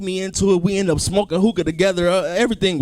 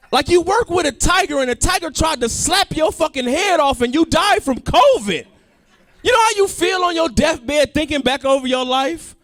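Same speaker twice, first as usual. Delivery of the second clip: incredulous rant; 9 semitones higher than usual. Real, not acted. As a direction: yell it at the absurdity. Indignant rant about Roy's death.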